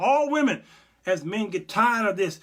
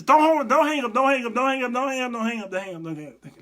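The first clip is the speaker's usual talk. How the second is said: frantic pleading fading; 7 semitones higher, 6 dB louder, faster than usual. Pretend. Begging Jesus, fading out.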